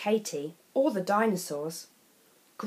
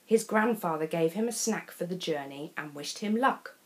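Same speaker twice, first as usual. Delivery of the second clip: usual speaking voice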